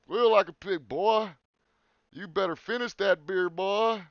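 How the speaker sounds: high, loud hammed-up backwoods threat; Deliverance hillbilly impression. Echoing the movie line to his cohost in a loud hillbilly voice. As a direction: bellow it like the movie villain. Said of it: "Doing his best Ned Beatty impersonation".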